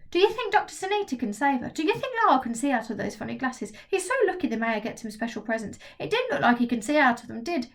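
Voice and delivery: put-on worried young boy rattling off questions; anxious kid Trig. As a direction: fret it out fast like a nervous boy.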